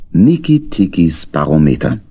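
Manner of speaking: ordinary delivery, nothing marked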